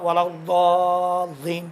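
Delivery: الضالین with heavy emphatic daad, slow; slow demo stressing the daad in الضالین; careful daad articulation. Shown as a demonstration. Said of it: "ضاد کا مخرج".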